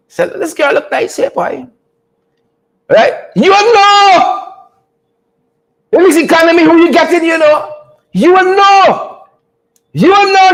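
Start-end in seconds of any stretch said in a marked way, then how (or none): 3.3-4.4 mock preacher call. Pastor-style comic warning to viewers, drawn out and high. 8.1-10.5 mock preacher call. Pastor-style comic warning to viewers, drawn out and high.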